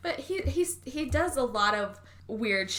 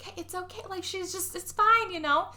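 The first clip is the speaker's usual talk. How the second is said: soothing mother; 10 semitones higher than usual. Retold or imitated.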